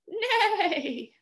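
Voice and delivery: high, wavering whinny; horse neigh. Pretend. Reader plays horse for the children, neighing. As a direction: let the neigh shake as it falls. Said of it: "neigh like a horse".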